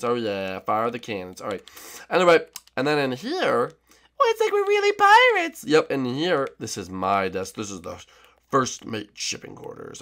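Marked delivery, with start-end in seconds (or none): 4.2-5.6 put-on high sweet girly voice; Birdo voice. To Yoshi in the morning, high sweet Birdo voice. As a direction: pitch way up as a sweet girlfriend.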